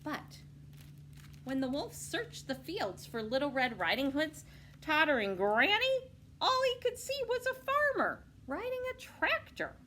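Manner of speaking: ordinary delivery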